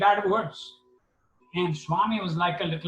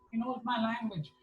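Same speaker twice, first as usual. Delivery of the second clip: higher, dropping much softer; joyful, softer repeat; 5 semitones higher, 8 dB quieter than usual. Retold or imitated. Baba repeats it in hushed delight, higher. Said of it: "and he was so happy".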